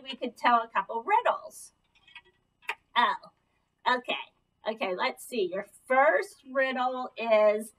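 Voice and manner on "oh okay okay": ordinary delivery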